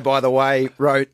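usual speaking voice

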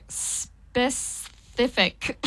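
"Specific." slow with a lisp on the S. Shown as a demonstration. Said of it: "Like, like a lisp".

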